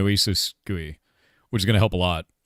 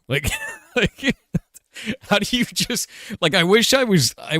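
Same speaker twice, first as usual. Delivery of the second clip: high-pitched laughing disbelief; disbelieving laugh; 12 semitones higher than usual. Real, not acted. Streamer laughing in disbelief at gullible viewers. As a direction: laugh it off, exasperated.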